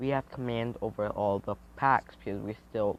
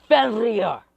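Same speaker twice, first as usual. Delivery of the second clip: loud, high anime attack cry; high, full-voiced shout; shouted power call; 10 semitones higher, 10 dB louder than usual. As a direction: yell the name as you leap. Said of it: "jumping out the window, saying".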